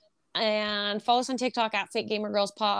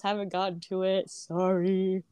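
talking through a yawn; 3 semitones lower than usual. Real, not acted. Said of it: "Sorry, I'm yawning".

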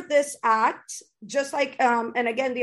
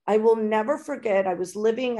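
ordinary delivery

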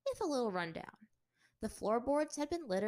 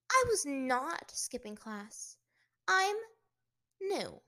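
indignant protest; 4 semitones higher, 6 dB louder, slower than usual. Acted. Bored student protests to teacher.

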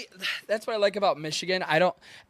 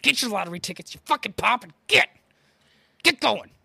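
raised, exasperated outburst; impatient rant; 5 semitones higher than usual. Acted. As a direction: lose patience, pitch climbing to the end. Impatient behind old people in line.

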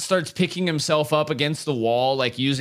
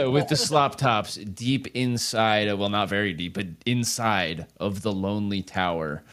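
closing narration; 4 semitones lower than usual. Acted. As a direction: bring the episode to a solemn close. Season finale closing narration.

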